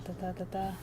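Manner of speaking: ordinary talk, nothing marked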